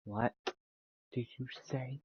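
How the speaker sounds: soft, dark threatening question; menacing hush. Acted. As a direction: lean in and threaten quietly. Character threatens a taunting rival.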